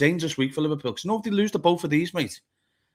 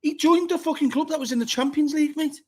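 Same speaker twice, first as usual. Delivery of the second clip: much higher, indignant protest; heated outrage; 12 semitones higher than usual. Real, not acted. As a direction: hammer the point home in disbelief. Heated argument about the manager.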